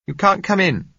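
put-on young woman calling out. A narrator's reading. Josella calls through door.

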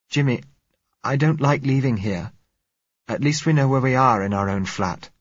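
a hint of a scared young woman; anxious girl. Frightened girl voiced.

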